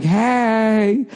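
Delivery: high, drawn-out sassy hey. Retold or imitated.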